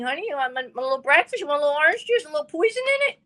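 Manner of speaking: rising sugary mock tenderness; mock sweet poisoner. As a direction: sweeten it until it turns sinister. Mocking poisoner husband's sweet offer.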